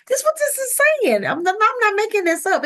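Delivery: high, fast and loud; high excited protest; excited insistence. Real, not acted. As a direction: burst out insisting it's true.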